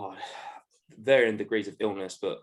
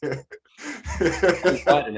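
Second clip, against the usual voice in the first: high, slow strained laugh; mock struggle, cracking up; 5 semitones higher, 6 dB louder, much slower than usual. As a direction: squeeze it out through laughter. Acting out fighting a zebra.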